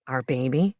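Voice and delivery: a proud, grinning groom-to-be; Marcus, playful fiancé. A narrator's reading. Marcus surprised.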